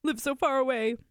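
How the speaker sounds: whiny, droning mock apology; mock confession. Woman imagining the confession she expected from him, mock whining. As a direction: imitate a sheepish man's sorry excuse. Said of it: "Expecting him to be like".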